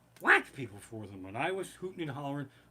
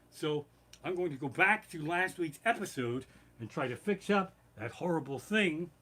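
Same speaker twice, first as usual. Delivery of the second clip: ordinary delivery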